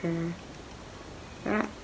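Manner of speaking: pulling tray off teeth; speaking around clear aligners; fingers in mouth. Real, not acted.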